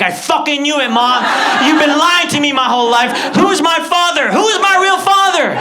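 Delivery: high, loud accusation building to a scream; outraged shout. Acted. Son demanding the truth from his mother, outraged shout.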